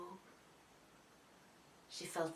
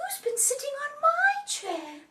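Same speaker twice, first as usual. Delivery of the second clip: very high, loud Mummy bear; mummy's voice; 8 dB louder than usual. A narrator's reading. Mummy bear finds her chair disturbed, high mummy voice. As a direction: exclaim it high as a mother bear. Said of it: "says in her mummy's voice".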